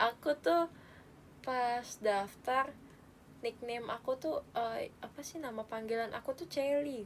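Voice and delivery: ordinary talk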